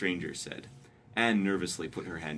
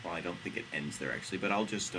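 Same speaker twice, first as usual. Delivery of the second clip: ordinary talk, nothing marked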